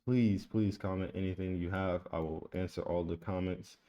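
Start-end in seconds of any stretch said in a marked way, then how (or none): none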